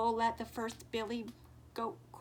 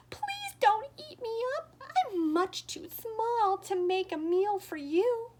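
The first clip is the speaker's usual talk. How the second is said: pleading; 10 semitones higher, 8 dB louder than usual. Acted. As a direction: beg the troll not to eat him. Little goat pleads with troll.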